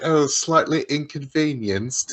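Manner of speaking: slow, mock-posh British; exaggerated posh British impression, drawn out; very posh accent. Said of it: "I love her very posh accent".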